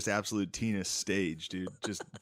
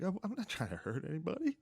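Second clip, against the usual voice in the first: quivering voice; 7 semitones higher, 10 dB quieter than usual. Retold or imitated. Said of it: "felt my fucking lip quiver".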